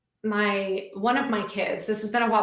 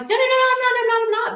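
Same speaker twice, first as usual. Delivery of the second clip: very high, louder rush of reassurance; mock denial; 13 semitones higher, 5 dB louder, faster than usual. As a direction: play an over-eager, squeaky denial. Imagined hasty denial to child.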